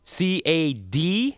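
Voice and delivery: slow, letter by letter, bewildered; puzzled spelling. Acted. Host baffled by the alphabet, slow puzzled spelling.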